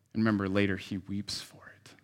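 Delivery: soft, low whisper; whispered aside. Real, not acted. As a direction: drop to a hushed aside. Aside on Jesus weeping.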